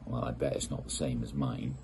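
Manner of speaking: ordinary delivery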